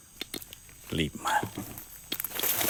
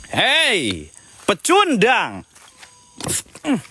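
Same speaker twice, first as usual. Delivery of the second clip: high mocking taunt; sneering panto villain; 7 semitones higher, much slower than usual. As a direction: jeer at the loser. Taunting the spirit.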